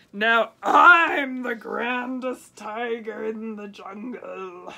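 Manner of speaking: slow, loud, drawn-out boast; grand tiger boast. A narrator's reading. Tiger walking off boasting about the shoes, slow and loud. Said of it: "went away saying".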